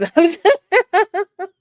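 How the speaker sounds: a short, high, loud burst of laughter; sudden laugh. Real, not acted. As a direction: laugh out, caught off guard. Laughs at own claim.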